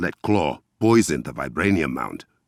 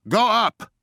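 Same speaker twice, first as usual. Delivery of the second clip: shouted command. Acted. Battle command.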